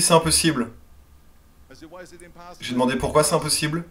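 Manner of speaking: normal talking voice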